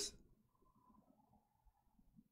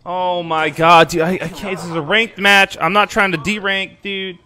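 exasperated plea, raised and louder; higher, loud gamer frustration; 5 semitones higher, 7 dB louder than usual. Real, not acted. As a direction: protest in real frustration. Frustrated mid ranked match.

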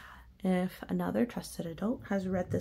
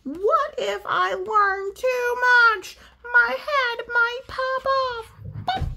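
much higher, louder, slow pigeon cry; panicked pigeon; 9 dB louder, much slower than usual. Acted. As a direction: wail it out in alarm.

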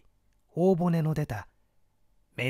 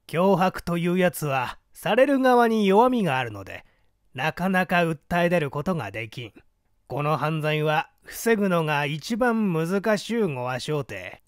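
scheming man; 4 semitones higher, 6 dB louder than usual. Acted. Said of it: "頬骨の出た男が言うのだ".

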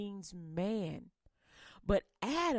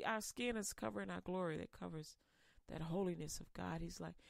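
ordinary talk

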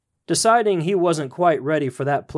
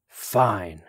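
low, beaten, giving in; trapped devil grudgingly giving up; hoarse, defeated; 6 semitones lower than usual. A narrator's reading. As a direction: concede through gritted teeth, worn out. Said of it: "Old Nick's voice was hoarse, defeated".

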